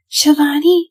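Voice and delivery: loud, high imitation of the uncle's call; copying Uncle Ram. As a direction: mimic the uncle shouting her name. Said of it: "I copy his voice".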